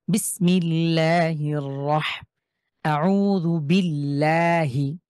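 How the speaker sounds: বিল্লাহি very slowly, recited, with a thin l; light l recitation. Shown as a demonstration. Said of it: "একটু বাঁকা করে পড়ছি".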